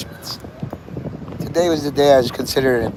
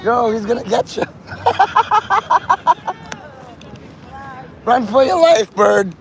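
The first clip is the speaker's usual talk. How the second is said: excited cheering; 9 semitones higher than usual. Real, not acted. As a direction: cheer the bird on as it flees. Cheering the chase.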